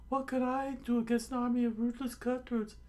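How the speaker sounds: very high, strong falsetto protest; high cartoon coward; cowardly protest. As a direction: squeal the excuse. First take of repeated excuse.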